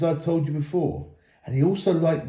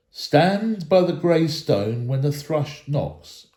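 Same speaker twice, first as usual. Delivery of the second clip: slow solemn reading; slower than usual. Acted. Elrond reads the moon letters to the dwarves, slow and solemn. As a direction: read slowly, like an ancient verse. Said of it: "read Elrond".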